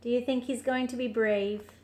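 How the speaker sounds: plain reading voice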